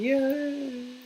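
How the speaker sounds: high sarcastic yay; mock cheer. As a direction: cheer it with irony.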